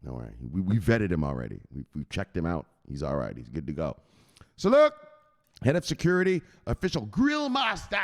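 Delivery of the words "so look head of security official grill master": high, punchy title shout; hype announcer. Acted. Host introducing the head of security and grill master, hyped announcer. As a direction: belt out the titles like a ring announcer.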